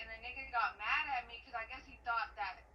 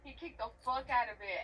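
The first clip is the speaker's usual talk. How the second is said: usual speaking voice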